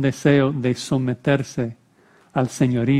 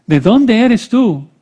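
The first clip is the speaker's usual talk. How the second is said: high, loud, slow interrogation; demanding question; 8 semitones higher, 6 dB louder, slower than usual. A narrator's reading. Pilate questioning Jesus in the praetorium, demanding question. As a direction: demand it with drawn-out force.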